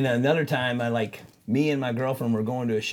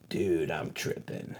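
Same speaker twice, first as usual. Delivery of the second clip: low, slow and hushed; dazed confession; 9 semitones lower, 9 dB quieter, much slower than usual. Retold or imitated. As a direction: imitate a stunned, spaced-out admission. Retold dazed confession mid-tattoo.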